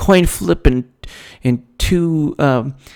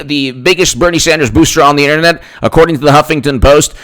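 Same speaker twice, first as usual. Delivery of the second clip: ordinary delivery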